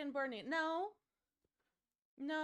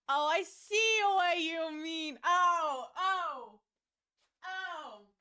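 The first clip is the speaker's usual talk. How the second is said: loud, slow, frightened wail; scared cry; 2 semitones higher, 9 dB louder, much slower than usual. Real, not acted. Streamer sees the spider, loud frightened wail. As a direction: let the fear out in a loud drawn cry.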